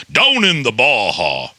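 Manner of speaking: Jesse Ventura impression. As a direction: bark it like a wrestler-governor robot. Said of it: "He goes".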